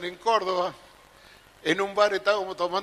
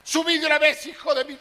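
higher, louder mock scolding; calling him up; 8 semitones higher, 3 dB louder than usual. Wife orders drunk husband upstairs, loud mock yell. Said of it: "Le gritaba a la vieja".

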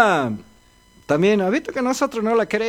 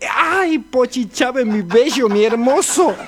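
swooning fan; 6 semitones higher, 4 dB louder than usual. Acted. Old woman swoons over singer.

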